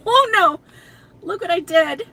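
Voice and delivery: high, loud startled cry; real dismay. Real, not acted. Woman just broke the duster, loud dismay. Breaks the duster while tapping.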